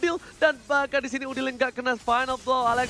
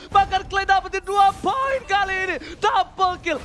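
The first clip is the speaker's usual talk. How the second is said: loud, high hype call; excited play-by-play; 6 semitones higher, 6 dB louder than usual. Acted. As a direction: call the kill streak at full force. Live hype commentary.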